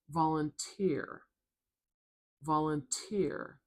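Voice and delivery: slow "Volunteer" with a crisp puff on the T; sharp aspirated T. Shown as a demonstration. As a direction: pop the T that starts TEER in "Volunteer". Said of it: "sharp, loud T sound with a little puff of air".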